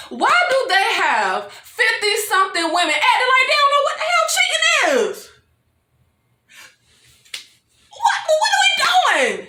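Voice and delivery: rising pitch, baffled by the show; exasperated disbelief. Real, not acted. Fan fed up with the cheating plot, high exasperation. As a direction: climb into a high, incredulous squeal.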